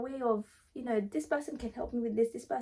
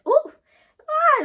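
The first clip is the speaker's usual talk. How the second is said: high excited ooh-ah squeal; 10 dB louder than usual. Real, not acted. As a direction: burst out with a thrilled squeal. Sudden excited outburst mid-list.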